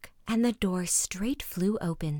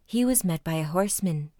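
usual speaking voice

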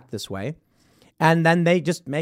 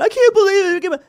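high, louder hammed-up whine; mock whiny outrage; 6 dB louder than usual. Mocking the overreaction he never had, hammed-up whine. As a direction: parody someone throwing a fit.

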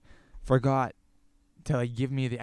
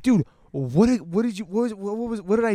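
flustered anger; 7 semitones higher, 6 dB louder than usual. Retold or imitated. Retelling the angry phone call.

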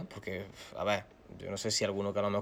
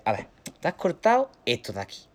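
doctor; 7 semitones higher, 5 dB louder than usual. Retold or imitated. Quotes the doctor.

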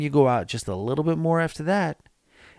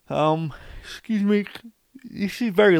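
words stretched through a real yawn; 9 semitones higher than usual. Real, not acted. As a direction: talk mid-yawn, late at night. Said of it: "and I am yawning on this podcast".

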